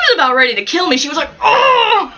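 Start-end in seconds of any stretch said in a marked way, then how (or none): 1.4-2.1 high hammed-up "oh" of horror; mock appalled gasp. Mom hearing her kid beg a stranger, mock appalled gasp. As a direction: imitate a mortified mother's outcry. Horrified mom reacting in retelling.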